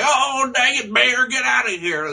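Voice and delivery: put-on high-pitched grumpy old man. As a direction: holler it like a fed-up old-timer. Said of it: "like, the old man".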